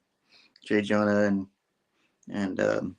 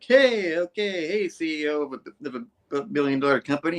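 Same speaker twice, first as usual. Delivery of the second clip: mock boasting; 6 semitones higher than usual. Harry bragging at Gwen over dinner, high mock boast. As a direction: puff yourself up like a smug heir. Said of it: "Harry's all like".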